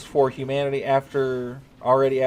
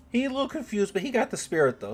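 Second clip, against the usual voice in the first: high teasing voice; 10 semitones higher than usual. Acted. Teasing reply to chat.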